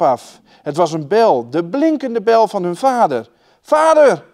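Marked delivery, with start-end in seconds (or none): 3.6-4.3 high, loud call into the woods. A narrator's reading. Children calling for their lost father, loud high shout. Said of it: "riepen ze".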